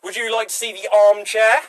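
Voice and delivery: eager shop assistant. Pretend. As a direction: sell the armchair brightly.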